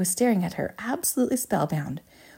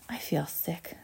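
soft, whispery queasy voice; queasy and weak; 9 dB quieter, slower than usual. A narrator's reading. Violet feels ill while swelling, faint queasy murmur. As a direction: let it out faintly, feeling ill.

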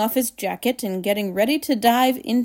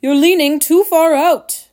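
loud, higher warning; raised-voice fatherly warning; shouting; 6 semitones higher, 7 dB louder than usual. A narrator's reading. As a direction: warn him urgently. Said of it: "shouted Mister Gloop".

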